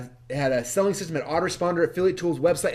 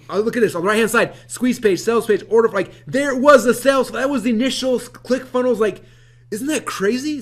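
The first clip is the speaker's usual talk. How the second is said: higher and louder, amazed; excited showing; 8 semitones higher, 6 dB louder than usual. Real, not acted. Excited showing old mock-ups.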